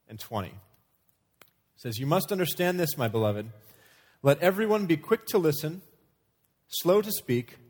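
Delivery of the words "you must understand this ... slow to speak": high, loud, emphatic Bible reading; scripture reading. Acted. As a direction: proclaim the verse in measured phrases with pauses. Reads a Bible verse aloud.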